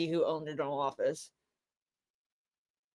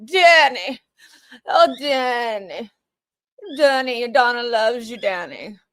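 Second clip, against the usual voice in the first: slow, higher, fake-weepy swooning; melodramatic mock plea; 4 semitones higher, 4 dB louder than usual. Mocking the defendant's theatrical courtroom plea, fake weepy. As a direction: overplay a damsel's tearful plea. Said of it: "put her hand up above her forehead and be like".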